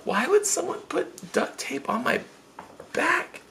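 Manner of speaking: high, soft, sleepy complaint; half-asleep, bewildered whining; tired whine. Retold or imitated. Sleeper puzzled by tape on his back, high tired whine. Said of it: "I'm so tired".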